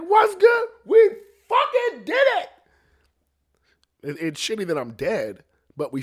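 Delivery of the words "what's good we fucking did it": loud, high celebratory holler; whooping, high-pitched victory yell; triumphant shout. Retold or imitated. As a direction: shout it like greeting the crew after a win. Acts out celebrating with everybody.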